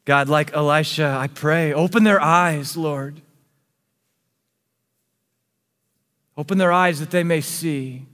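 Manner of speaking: earnest spoken prayer over music, rising and sinking. Acted. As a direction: pray fervently for the congregation. Leads a closing prayer.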